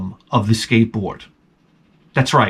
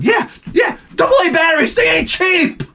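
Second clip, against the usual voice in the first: much higher, mocking yelp at the cost; sarcastic mock voice. Dad mocking his son's battery plan, high sarcastic yelp. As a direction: squeak it out like a fed-up dad mocking.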